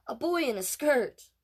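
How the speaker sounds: put-on high, loud, jeering kid voice; older boy jeering at Casey; mocking. A narrator's reading. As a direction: taunt him.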